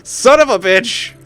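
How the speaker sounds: slow curse into squealing laughter; laughing curse. Real, not acted. Streamer cursing then cracking up, laughing. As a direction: dissolve into a high laugh.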